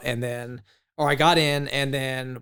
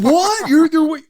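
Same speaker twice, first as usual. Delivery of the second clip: high, loud burst of disbelief; mock freak-out; 11 dB louder, slower than usual. Shipmates hearing about Starbucks, hammed-up shock. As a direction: exaggerate a stunned friend's reaction. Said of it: "like, freaking out".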